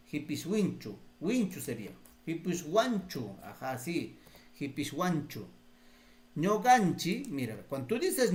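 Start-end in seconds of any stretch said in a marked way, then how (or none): none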